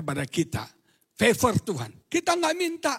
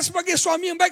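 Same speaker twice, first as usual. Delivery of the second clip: normal talking voice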